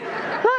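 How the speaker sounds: short airy giggle; high breathy laugh. Real, not acted. The preacher laughs at her own mock vow, breathy giggle. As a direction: laugh at your own joke.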